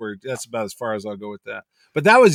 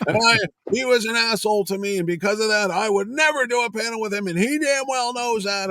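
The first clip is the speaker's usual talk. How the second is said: acted-out grievance, pitched well up; retold indignant rant; 8 semitones higher than usual. Artist explaining his grudge to the organizer, acted indignant rant. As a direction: imitate an aggrieved artist, high-pitched.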